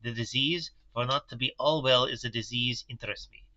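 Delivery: put-on Van Helsing's German accent, grave; thoughtful German professor, measured. Professor reports his careful examination to the doctor, German accent. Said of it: "the voice that I'm working with".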